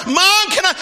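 put-on very high, loud child's holler; insistent little boy, acted out; yelling downstairs. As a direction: shout for Mom at full pitch. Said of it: "he yelled down one more".

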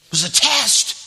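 "test" punched high and loud; "test" cried out as the key word; emphatic repeat. Real, not acted. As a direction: drive home the word "test" with a shout. Preacher repeats point forcefully.

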